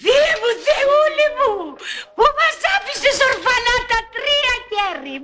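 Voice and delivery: slow, hammed-up lament with a fake sob; mock wailing. Heir hams up grief at the wake, slow fake wail. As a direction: wail it to heaven, overdone. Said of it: "Μην κλαις πολύ, θα σου φύγει το ρίμελ".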